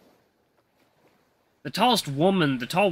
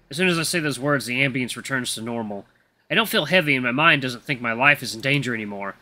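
plain reading voice

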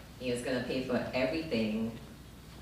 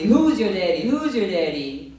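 mock American guy; 5 semitones higher, 9 dB louder than usual. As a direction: punch out the pickup line. American guy asking her dad.

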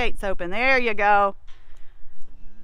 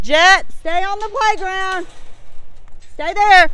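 higher, louder command to the dog; calling to the dog; 6 semitones higher, 4 dB louder, slower than usual. Real, not acted. Owner calling her dog to stay on the playground, firm loud call. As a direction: call out firmly so the dog stays put.

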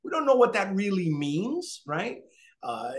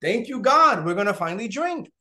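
relieved thanks; 6 semitones higher, 5 dB louder than usual. Retold or imitated. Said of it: "They're probably saying to themselves".

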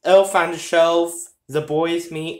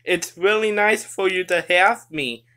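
usual speaking voice

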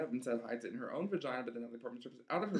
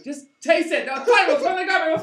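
much higher, much louder nagging shriek; shrieking mom; 17 dB louder than usual. Acted. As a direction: screech at her to taste it. Mother insists, shrieking.

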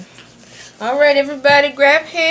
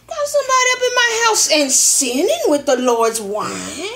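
indignant scolding; 4 semitones higher, 5 dB louder than usual. Acted.

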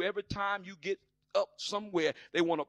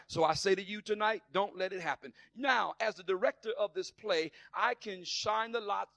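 ordinary talk, nothing marked

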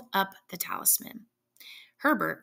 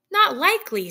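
loud, high mock horror; pretended horror; 8 semitones higher, 9 dB louder than usual. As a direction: ham up being shocked. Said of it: "with pretended horror".

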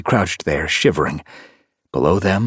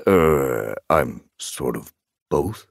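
low, slow, hesitant answer; embarrassed admission; 5 semitones lower, much slower than usual. A narrator's reading. Tom admits his double life, embarrassed and hesitant. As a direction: own up reluctantly, drawing out the words. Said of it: "Billy Storm admitted".

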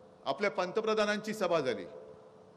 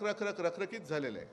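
normal talking voice

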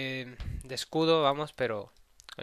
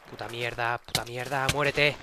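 ordinary talk

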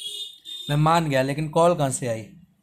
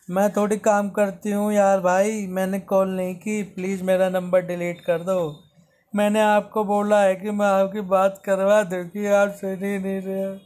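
put-on pleading girl; 6 semitones higher than usual. Vlogger mimics the girl begging him to delete the number, high pleading voice. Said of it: "तो बंदी रोने लगी".

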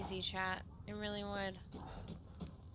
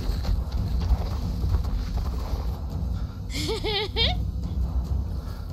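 high cackle of relief; loud panicked laugh; 10 semitones higher, 8 dB louder than usual. Real, not acted. Streamer laughing off the fright.

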